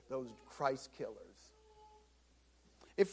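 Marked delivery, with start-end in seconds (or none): 0.1-1.5 bitter derogatory sneer. Retold or imitated. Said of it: "in a real bitter".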